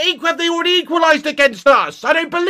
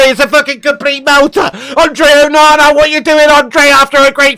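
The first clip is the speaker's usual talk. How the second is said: anguished shout; 8 dB louder than usual. Real, not acted. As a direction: bellow in real disbelief at the keeper. Shouting at conceded goal live.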